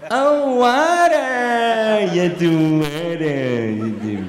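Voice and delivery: long drawn-out American recognition, gliding down; exaggerated American accent. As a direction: stretch it hugely, like a relieved American. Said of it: "speak to them in their own accent".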